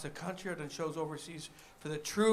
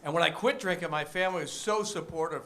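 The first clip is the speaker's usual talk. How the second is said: normal talking voice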